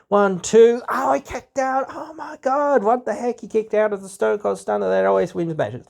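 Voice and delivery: high, shocked fan gasping at a kickout; mock excited fan. Imagined fan hearing the count, in high mock shock.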